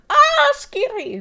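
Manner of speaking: high, loud, drawn-out fake scream; mock fright. Streamer mocking how scary the monster is, mock fright. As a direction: fake a big shriek of fear. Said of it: "No, it is not scary".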